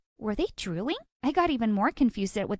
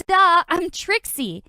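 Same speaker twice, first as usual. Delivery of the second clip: loud, slow, exasperated duh; 7 semitones higher, 6 dB louder, slower than usual. Acted. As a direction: drag it out like it's obvious. Annoyed obvious reply.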